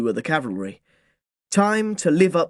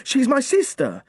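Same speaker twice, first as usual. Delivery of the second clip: ordinary delivery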